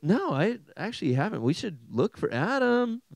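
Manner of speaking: high, sing-out mock worry; clueless Trinity member. The Trinity looking for lost Adam, high clueless voice. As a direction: pitch it up as a hapless searcher. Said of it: "they're like".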